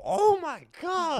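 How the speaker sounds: slow, very high amazed shriek; a stretched-out, high-pitched oh my God; commentator gasp. Retold or imitated. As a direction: imitate an excited commentator losing it. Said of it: "I just hear her go".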